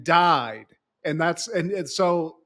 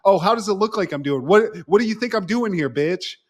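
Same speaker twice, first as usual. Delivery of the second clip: fast, louder mock snap; mock snapping; 5 semitones higher, 4 dB louder than usual. Host voicing David snapping at Donna, fast mock snap. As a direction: bite it off rudely.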